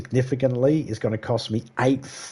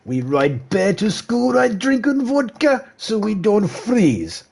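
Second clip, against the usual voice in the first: high, slow cartoon Russian accent; bad Russian accent; 10 semitones higher, slower than usual. Streamer ends the bad Russian accent bit with bears and vodka. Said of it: "that very, very bad accent".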